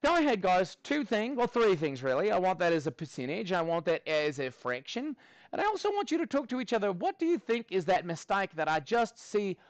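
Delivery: lively put-on Australian accent; rally the class in a high, playful Aussie accent. Teacher setting students talking to each other, lively Aussie accent. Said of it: "we'll do this in an Aussie accent".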